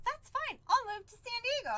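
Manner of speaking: high-pitched, eager imitation of a boyfriend; agreeable suitor. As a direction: voice him giving in readily. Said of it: "And he goes".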